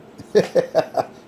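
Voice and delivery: short real chuckle at one's own jibe; amused chuckle. Real, not acted. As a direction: chuckle briefly, amused by your own dig. Mocking Cracky's fallen antenna.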